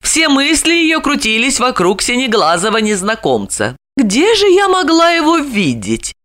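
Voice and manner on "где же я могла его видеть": tense wondering. A narrator's reading. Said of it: "напряжённо гадала она".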